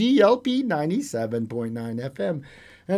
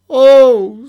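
dismayed cry; 12 semitones higher, 15 dB louder than usual. Acted. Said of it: "said the farmer".

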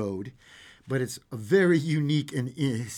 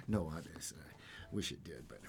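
slow, soft whispered aside; confiding whisper to the listeners; 9 semitones lower, 17 dB quieter, slower than usual. Real, not acted. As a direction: whisper it under the breath.

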